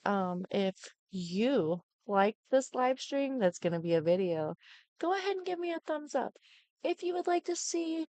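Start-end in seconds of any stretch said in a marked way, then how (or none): 5.0-8.1 high sing-song request. Real, not acted. Asking viewers for likes.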